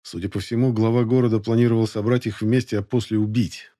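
plain reading voice

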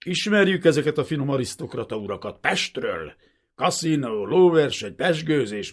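loud, high furious tirade; vengeful shouted rant; ranting, snarling. A narrator's reading. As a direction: spit threats and accusations without letup. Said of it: "Egy végtéből acsarkodott".

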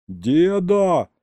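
an exasperated grandson shouting at grandpa; sharp shout. Acted. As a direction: yell to stop the old man mid-story. Hero cuts off grandpa.

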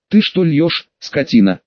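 ordinary delivery

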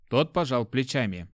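plain reading voice

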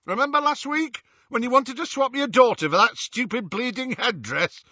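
high, rough, scolding thug; angry berating. A narrator's reading. Death Eater berates his captive.

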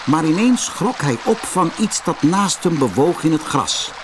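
plain reading voice

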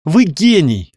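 high, delighted cry of thanks; grateful exclamation. A narrator's reading. Каролина praises the doctor's idea, exclaiming gratefully. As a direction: exclaim in a burst of gratitude. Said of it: "воскликнула Каролина и в порыве искренней благодарности".

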